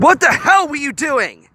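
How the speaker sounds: furious shout, high and loud; angry demand. A narrator's reading. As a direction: yell it at him in fury. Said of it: "Hiro demanded".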